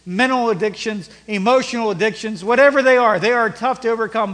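loud, urgent pulpit exhortation; forceful preaching. Acted. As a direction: preach it hard, punching key words.

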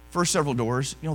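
ordinary delivery

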